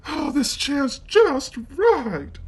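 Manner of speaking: put-on high, slow, satisfied girl's voice; pleased little girl. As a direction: sink in happily, voiced high. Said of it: "she said, settling down".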